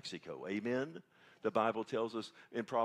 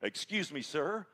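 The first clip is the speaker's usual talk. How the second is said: mildly puzzled, raised pitch; 5 semitones higher, 4 dB louder than usual. Retold or imitated. Receptionist answering a strange caller, mock puzzled. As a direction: imitate a politely baffled receptionist, higher. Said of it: "Merlinda was very puzzled".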